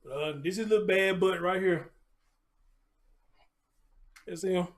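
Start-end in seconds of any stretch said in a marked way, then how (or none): none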